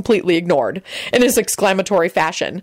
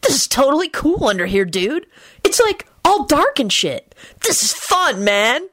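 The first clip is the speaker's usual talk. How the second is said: high-pitched slacker voice; higher, goofy enthusiasm; Kenny, laid-back dude; 8 semitones higher than usual. A narrator's reading. Kenny chatting under the table.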